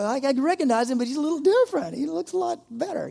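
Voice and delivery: high, climbing mock amazement; amazed onlooker. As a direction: pitch it up like someone marvelling at a changed look. Acts amazed onlooker in heaven.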